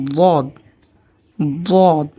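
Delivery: দদ opened midway between d and z; দদ as emphatic "ddod"; heavy Arabic Dad. Shown as a demonstration. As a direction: demonstrate দদ with a thick, held d. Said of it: "দুটার মাঝামাঝি".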